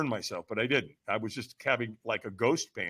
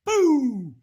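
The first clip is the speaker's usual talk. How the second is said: high, loud drawn-out burst; sudden exclaimed sound effect; explosive launch word; 10 semitones higher, 5 dB louder than usual. Retold or imitated. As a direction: mimic a rocket taking off. Imitates the launch onto coals.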